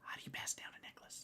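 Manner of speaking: hushed, breathy whisper. Real, not acted. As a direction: whisper it like a puzzled aside. Puzzled aside about the necklace.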